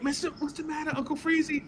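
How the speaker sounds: put-on high, soft girlish concern; high soft mock girls asking; sweet girls. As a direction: coo like concerned schoolgirls. Girls consoling him.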